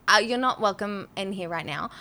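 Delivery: ordinary talk, nothing marked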